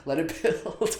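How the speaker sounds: ordinary talk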